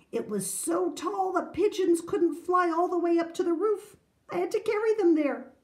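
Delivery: high, building tall-tale voice; excited boasting. A narrator's reading. Grandfather telling Julie of his castle, excited boasting. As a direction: pile on the exaggeration, high and eager.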